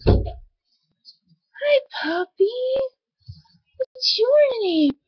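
high, soft puppy talk; baby talk to puppy. Acted. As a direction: coo at the dog. Talking sweetly to a puppy.

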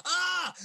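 loud, high cry of pain; pained scream. Acted. David with his arm being twisted, in pain. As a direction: yelp as your arm is twisted.

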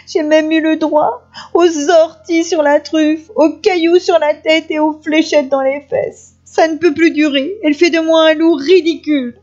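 high, whining, hammed-up crying; sobbing wolf. A narrator's reading. Wolf telling grandmother of the girl's insults, tearful wail. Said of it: "répondit le loup entre deux hoquets".

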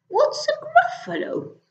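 very high hooting question; puzzled owl. Acted. Owl baffled by the Gruffalo, very high. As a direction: let the question soar up.

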